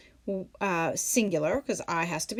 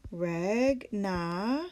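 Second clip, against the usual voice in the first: ordinary talk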